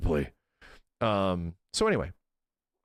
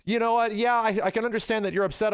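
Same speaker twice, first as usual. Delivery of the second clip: high, placating assistant voice; mock-sympathetic robot soothing a customer; 7 semitones higher than usual. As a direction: imitate an over-understanding bot in raised pitch. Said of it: "having a conversation with a robot".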